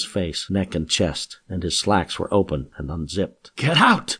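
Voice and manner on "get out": high, loud scream. A narrator's reading. Johanna screaming at her attacker. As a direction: scream it at him to get out. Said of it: "Johanna screamed".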